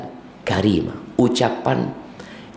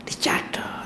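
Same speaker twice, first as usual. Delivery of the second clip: whispered; 9 dB quieter than usual. Acted. Whispered emphasis.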